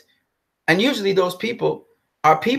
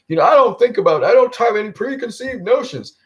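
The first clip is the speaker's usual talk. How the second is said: mocking imitation; 7 semitones higher than usual. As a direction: parody someone pretending to be unbiased. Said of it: "they trolling".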